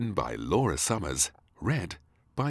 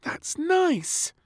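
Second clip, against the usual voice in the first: put-on high, delighted child; pleasantly surprised girl; surprised little princess; 7 dB louder, much slower than usual. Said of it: "smiling in surprise".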